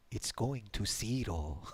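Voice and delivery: put-on slow Swedish-accented English.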